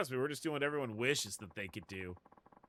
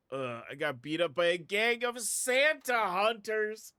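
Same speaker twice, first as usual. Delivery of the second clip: higher, slower voice of a beaten man; hurt Santa worker voice; 8 semitones higher, 6 dB louder, slower than usual. Acted. Streamer voicing the beaten Santa worker, pained and high. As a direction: groan it out like you just took a beating.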